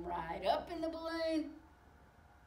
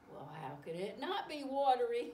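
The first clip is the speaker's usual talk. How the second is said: usual speaking voice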